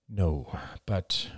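hesitant and soft; father gently refusing his son; soft regretful no. Acted.

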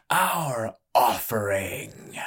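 slow dramatic whisper. Acted. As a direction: breathe it out slowly for effect. Ringmaster presents fighter.

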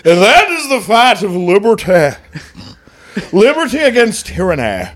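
put-on rising preacher oratory in a muddled Scottish-Southern accent; mock Scotch-Florida American. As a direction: proclaim it like a Southern preacher crossed with William Wallace's accent. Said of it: "Scotch Florida American".

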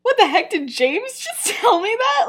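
high-pitched, baffled retelling; mock bewilderment with a laugh breaking in; confused and concerned. Lily reacts to James swearing at her, confused and concerned. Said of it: "so confused, s-, a little concerned".

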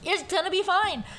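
high, sing-song reassuring; mock reassurance. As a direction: brightly humour him while shooing. Reassuring dad while sending him up.

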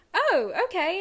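taken aback; mock surprised; surprised oh okay. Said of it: "And I was like".

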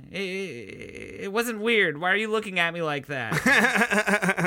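defensive, stammering witness; awkward witness. Acted. Witness defending himself to a staring court, awkward stammer. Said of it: "shifting his weight from foot to foot awkwardly".

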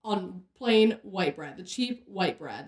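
each word laid down slowly; emphatic, slow. Real, not acted. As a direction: spell out exactly which bread she means. Insisting on exact bread.

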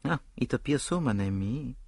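put-on soft, guarded French-accented reply; quietly hedging French detective; Poirot, cautious. A narrator's reading. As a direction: concede it carefully, lifting at the end. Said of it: "said Poirot cautiously".

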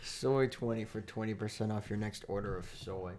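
usual speaking voice

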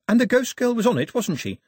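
put-on a girl leaning in, sure she's right; eager girl. Girl asking the old man about the ghost girl, eager. Girl's voice.

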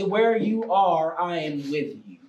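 solemn divine reassurance, slowly fading down; God. Retold or imitated. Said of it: "I heard the voice of God say".

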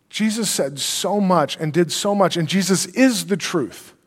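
usual speaking voice

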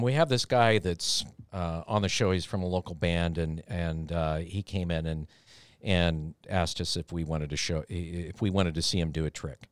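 imitating Carl confessing, low sheepish mumble. Carl explaining the stunt to his bosses, low sheepish mumble. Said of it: "Carl looks at me and goes".

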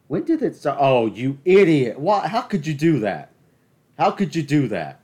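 incredulous outrage building; exasperated rant. Real, not acted. Ranting at shooting headline.

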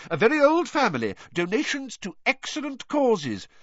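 high, indignant vouching; pompous, offended official; affronted. A narrator's reading. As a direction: defend them huffily, pitched high. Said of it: "visibly affronted".

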